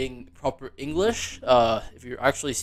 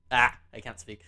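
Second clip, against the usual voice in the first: ordinary delivery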